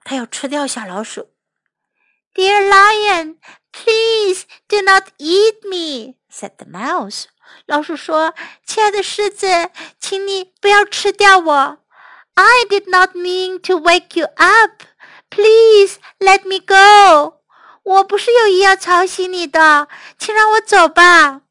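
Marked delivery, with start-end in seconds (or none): 2.3-6.2 high, slow pleading little mouse; begging mouse. Acted. Little mouse begs the lion not to eat him, pleading. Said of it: "kept talking and begging". 8.7-21.4 high-pitched, imploring mouse voice; begging mouse. Acted. Little mouse apologises to the lion, begging to go. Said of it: "kept talking and begging".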